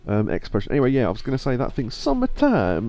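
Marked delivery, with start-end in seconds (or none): 1.9-2.9 put-on high, slow, hammy Italian accent; over-the-top fake Italian accent, drawn out; bad Italian accent. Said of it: "a really bad Italian accent".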